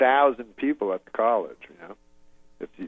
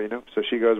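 usual speaking voice

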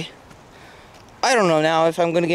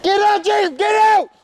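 high-pitched shout at the culprit; real angry yell; 5 dB louder than usual. Real, not acted. Kid yelling at Jacob after the phone drops, angry shout. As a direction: yell it high, demanding they move now.

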